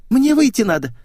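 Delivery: high-pitched, urgent plea; anxious girlish voice. A narrator's reading. Girl pleads with the driver, high anxious voice.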